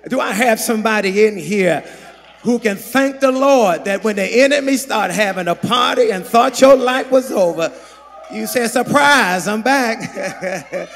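loud, rousing preacher's shout; rally the congregation in a triumphant shout; full-force preaching. Real, not acted.

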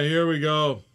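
ordinary talk

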